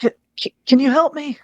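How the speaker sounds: high, lost ghost voice. Acted. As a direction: speak like a confused spirit.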